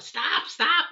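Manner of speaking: calling out over the mower noise; retold yell. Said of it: "I'm yelling out".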